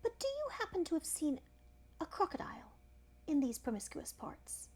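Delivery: put-on politely curious young elephant, voice starting high. A narrator's reading. Young elephant asking the crocodile about crocodiles, polite. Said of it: "said the elephant's child most politely".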